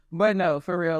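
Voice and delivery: usual speaking voice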